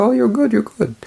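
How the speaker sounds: high bright mock compliment; gushing praise. Imitating Sophie praising her French, high and gushing. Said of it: "She's like".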